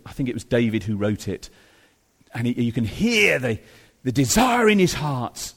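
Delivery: usual speaking voice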